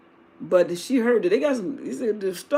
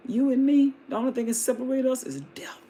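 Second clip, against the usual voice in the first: ordinary talk, nothing marked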